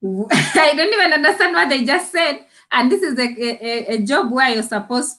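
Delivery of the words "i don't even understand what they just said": laughing quote. Retold or imitated. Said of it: "and I'm like".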